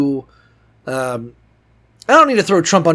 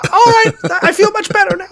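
high sarcastic homeless man; 4 dB louder, faster than usual. Retold or imitated. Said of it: "homeless guy under a bridge".

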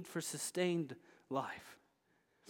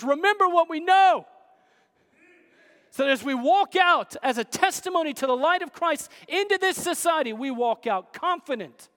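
raised, emphatic pulpit delivery; forceful preaching; 6 semitones higher, 8 dB louder than usual. Acted. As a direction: drive it home to the congregation.

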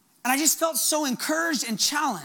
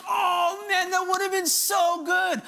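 high, drawn-out wail over a missed chance; eager disappointment, not mad; 6 semitones higher, slower than usual. Retold or imitated. Holy Spirit to preacher who missed it, excited disappointment. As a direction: lament it with excited regret, stretching the words. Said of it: "he's not mad at me".

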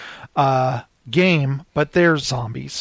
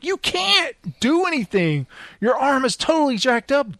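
high, disbelieving mock scolding; exasperated scold; 8 semitones higher than usual. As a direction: scold your stubborn buddy in disbelief. Scolding stubborn wounded friend.

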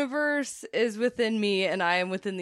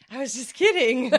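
louder, higher quoted kidding; lightly voiced psychic; 4 semitones higher, 6 dB louder than usual. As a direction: toss it off as a joke. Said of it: "She goes".

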